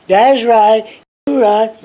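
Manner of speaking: emphatic agreement. Retold or imitated. Klansman conceding the point to his teacher, emphatic agreement. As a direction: imitate someone suddenly and forcefully conceding.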